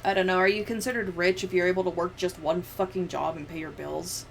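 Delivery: ordinary talk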